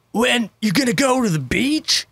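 mock stoner surfer dude. Shown as a demonstration. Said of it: "does that stupid-ass stereotypical surfer voice".

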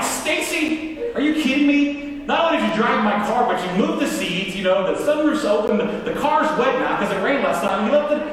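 indignant retold rant; mad. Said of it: "I'm mad".

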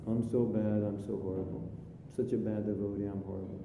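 low, soft, droning gloom; mock self-pity. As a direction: mope along as a self-hating devotee, voice sunk down. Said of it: "so I feel bad".

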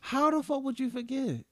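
high-pitched disbelief; incredulous. Real, not acted. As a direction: friend hears she forgot what he looked like, incredulous. Taken aback at being forgotten.

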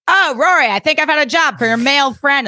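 high, loud busybody greeting; Miss Patty impression. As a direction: imitate a dance teacher calling out to a girl. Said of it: "Miss Patty's smoking, and she's like".

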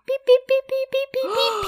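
high squeaky beep-beep mimicry; imitating beeping. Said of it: "I just hear peeping".

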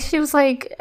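usual speaking voice

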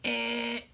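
game show buzz; mock buzzer noise; wrong-answer buzzer. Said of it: "made a buzzing noise".

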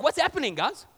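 David greeting the soldiers casually. Retold or imitated. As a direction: stroll in like a cheerful teen. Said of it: "David's like".

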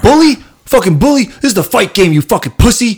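high, loud screeching parody of an angry fighter; mocking Jake Paul. Mocking a boxer's press conference rant, high and loud. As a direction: sneer it out like a whiny tough guy yelling back. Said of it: "and then Jake Paul's like".

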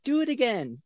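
put-on high, bright encouragement to a toddler; encouraging. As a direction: egg the kid on. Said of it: "I'll be like".

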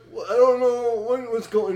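high, loud confused stammer; imitating bewildered son. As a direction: flounder like a teen yanked out of sleep. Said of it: "he thinks it's a dream".

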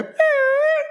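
very high lisped chirp; bird chirp. Retold or imitated. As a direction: squeak it like a small bird. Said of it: "my daughter will go".

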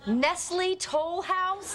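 "Nestle Toll House" said very slowly, American style; American butchering. Acted. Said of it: "butcher the French language".

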